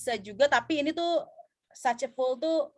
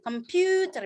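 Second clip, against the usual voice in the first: "computer" with PU stretched long; lengthened stressed syllable; 5 semitones higher, slower than usual. Shown as a demonstration. Teacher explaining stress can be lengthened, "computer" with long PU. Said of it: "stres itu yang bisa dipanjangin".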